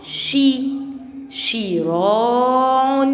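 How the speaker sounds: "shi" alone, then شراع stretched very slowly as "shi-raa-'un"; drawn-out kasra sound. Shown as a demonstration. Teacher shows the kasra to first graders, drawn-out "shi" in شراع. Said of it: "كسرة".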